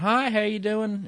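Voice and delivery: high-pitched Southern greeting; southern accent. Retold or imitated. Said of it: "He has this southern accent".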